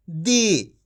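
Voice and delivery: दी said low with the ई pulled long; stretched long ii. Shown as a demonstration. Teacher models the long ii for students, stretched. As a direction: draw out दी slowly for the class. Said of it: "खींच के पढ़ा जाता है।".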